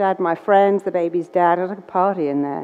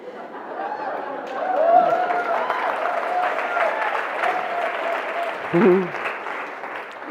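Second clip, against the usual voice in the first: long high laugh; 7 dB quieter than usual. Real, not acted. Audience-style laugh break.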